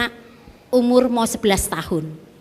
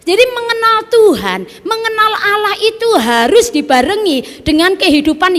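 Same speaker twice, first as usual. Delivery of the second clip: high, loud preacher's proclamation; preaching at full force; 9 semitones higher, 5 dB louder than usual. Acted. Preacher to the church, proclaiming loud and high. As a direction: ring it out to the congregation.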